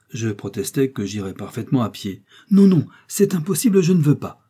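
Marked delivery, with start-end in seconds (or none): 2.5-4.4 high, louder refusal; acted insistent refusal; emphatic protest. A narrator's reading. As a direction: object firmly, pitch jumping up. Hostess protests against his walking.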